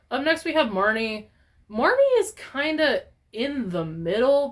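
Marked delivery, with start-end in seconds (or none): none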